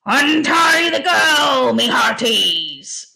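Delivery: big, slow pirate bellow; Barnacle Bill. A narrator's reading. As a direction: roar orders to the crew, gleefully stretched. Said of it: "grinned Barnacle Bill".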